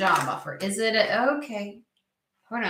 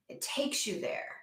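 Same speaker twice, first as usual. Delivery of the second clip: whispered "It takes you there"; a soft repeated whisper; 3 semitones higher, 11 dB quieter than usual. Shown as a demonstration. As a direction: breathe it out in a whisper. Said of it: "repeats line as whisper".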